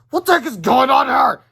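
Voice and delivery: high, loud angry yell. Acted. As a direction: bellow in confusion. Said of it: "Mister Crump yelled".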